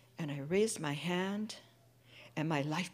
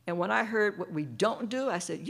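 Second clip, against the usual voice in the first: ordinary delivery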